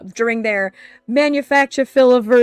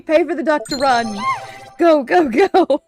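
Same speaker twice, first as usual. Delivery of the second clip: mock hype; 4 semitones higher, 4 dB louder than usual. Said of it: "where I say".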